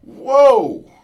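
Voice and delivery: high, loud whoa; the bear bellowing in surprise. Retold or imitated. Bear reacting as he hits the cage, loud whoa. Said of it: "That bear is like".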